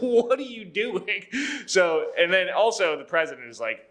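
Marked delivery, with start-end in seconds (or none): 0.0-1.2 slow, high mock protest through laughter; laughing disbelief. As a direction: draw it out in amused exasperation.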